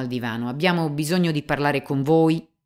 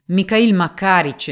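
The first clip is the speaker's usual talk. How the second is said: higher, louder, calling him to stop; urgent call; 8 semitones higher, 10 dB louder than usual. Acted. Young examiner to the police chief, urgent loud call. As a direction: cut in loud with his name.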